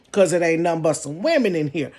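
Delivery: ordinary delivery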